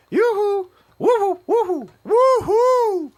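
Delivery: host imitating Zabrosk strolling and whooping, high and loud; mock woo-hoo calls. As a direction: whoop it like a drunk hype man. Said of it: "And he's going".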